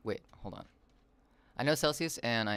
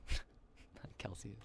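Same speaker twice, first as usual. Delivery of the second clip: whispered laugh; 9 dB quieter, much slower than usual. Real, not acted.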